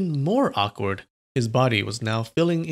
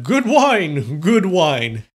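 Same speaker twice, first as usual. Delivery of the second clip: loud, higher tipsy voice; drunk man; 4 semitones higher, 6 dB louder, much slower than usual. Acted. Swordsman faking drunkenness to nuns, loud tipsy voice. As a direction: slur out praise for the wine. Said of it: "looking like a drunk".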